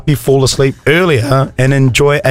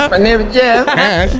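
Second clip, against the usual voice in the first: high slow Borat voice, laughing; Borat impression; 7 semitones higher, slower than usual. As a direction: drawl it like Borat. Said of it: "He sounds like Borat".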